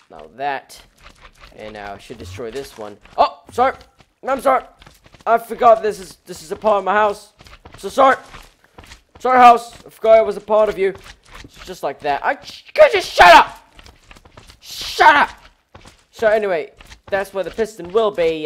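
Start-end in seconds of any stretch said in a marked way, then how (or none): 3.2-10.9 hammed-up apology. Apologizing to his own house. 12.2-15.3 higher and louder, snapping at the noise; annoyed shout. Real, not acted. Yelling at a noisy mob.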